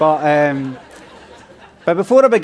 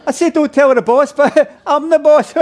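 high, louder put-on voice breaking into laughter; giggly character catchphrase; 9 semitones higher, 4 dB louder than usual. Character sharing a joke about the boss, high and giggly. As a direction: laugh through your own joke, pitched up.

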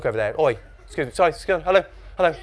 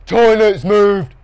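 high, loud, drawn-out repetition; louder repeat; 7 semitones higher, 8 dB louder, much slower than usual. A narrator's reading. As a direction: say it slowly and loudly for someone who missed it. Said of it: "a bit louder".

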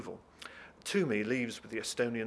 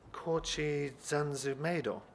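slow, higher puzzled echo; baffled foreigner; 3 semitones higher, much slower than usual. Acted. Woman baffled by Jacob's word, slow accented echo. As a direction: sound out unfamiliar English syllable by syllable. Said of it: "This baffles her".

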